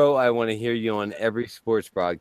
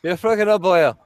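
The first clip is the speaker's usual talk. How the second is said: mock Irish accent; 6 semitones higher than usual. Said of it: "I'm too Irish".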